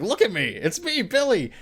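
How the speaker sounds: high, eager calling out; excited pleading. Retold or imitated. Fan voicing Billy grabbing Freddy, high excited plea. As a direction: make him see it's you. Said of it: "It's like".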